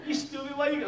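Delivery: high-pitched mock self-berating; hammed-up tantrum at oneself; frustrated self-scolding. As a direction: scold yourself in a high, worked-up voice. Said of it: "like, I'm talking to myself".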